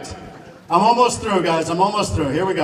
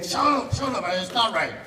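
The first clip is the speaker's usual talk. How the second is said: mock snapping; 5 semitones higher than usual. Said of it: "I always say".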